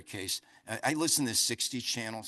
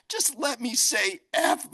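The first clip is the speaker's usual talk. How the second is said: higher, slow pained plea; 11 semitones higher, 5 dB louder, slower than usual. Retold or imitated. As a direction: beg to swear through the pain. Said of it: "I felt like saying".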